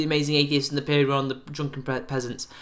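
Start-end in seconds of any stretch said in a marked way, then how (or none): none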